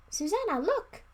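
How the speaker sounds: high, loud eager call; can barely contain the thrill; excited whisper. Acted. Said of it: "he whispered excitedly".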